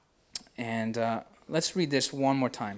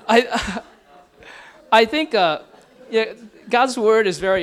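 real laughter running through louder, higher words; laughing while talking; 6 semitones higher, 8 dB louder than usual. Real, not acted. Pastor still laughing after his joke, trying to go on. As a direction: talk while still cracking up.

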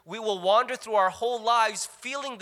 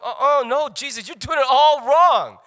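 frantic; 7 semitones higher, 9 dB louder than usual. Retold or imitated. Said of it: "I'm like".

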